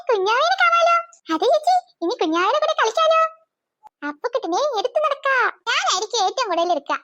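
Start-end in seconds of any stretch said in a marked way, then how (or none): 0.1-3.2 high, gleeful exclaiming; delighted. Acted. Sisters learning the baby is coming to stay, delighted. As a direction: squeal a little with happy anticipation.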